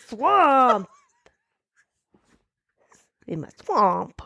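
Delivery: halting attempt at a Scottish Shrek; Scottish accent. Shown as a demonstration. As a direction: test out a Scottish accent on each try. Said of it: "where he does a Scottish accent".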